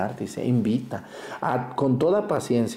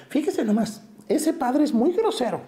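put-on high, whiny old-lady complaint; mock the women who gossip about the priest; mocking gossipy old woman; 12 semitones higher than usual. Priest mimicking a complaining churchwoman, high and whiny. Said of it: "Luego esas viejas dicen".

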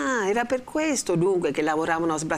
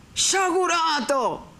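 much higher, slow, resonant shout; full-voiced cry; 12 semitones higher, 3 dB louder, much slower than usual. A narrator's reading. The friar thunders at vengeful Renzo, booming. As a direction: let the old power ring out. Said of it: "gridò il padre Cristoforo con una voce che aveva ripreso tutta l'antica pienezza".

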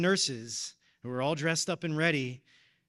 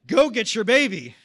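higher and much louder, beaming; pastor's happy command, louder and higher; joyful send-off; 7 semitones higher, 14 dB louder than usual. Retold or imitated. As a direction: push them out the door with joy.

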